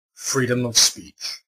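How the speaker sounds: usual speaking voice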